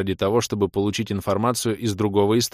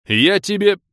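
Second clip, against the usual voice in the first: high, loud threat cut short; angry threat; 11 semitones higher, 7 dB louder than usual. Acted. Влас threatens his friend by phone, shouting. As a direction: start yelling a threat.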